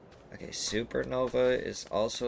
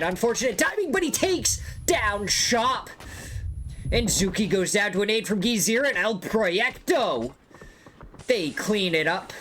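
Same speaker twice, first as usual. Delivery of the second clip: high, loud hyped commentary; excited play-by-play; 12 semitones higher, 4 dB louder than usual. Real, not acted. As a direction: ride the fight with rising hype. Caster calls a fast fight.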